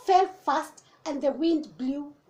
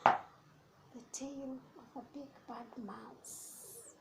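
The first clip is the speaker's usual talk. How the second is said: hushed; 16 dB quieter, slower than usual. Acted. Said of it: "Could this be the tail".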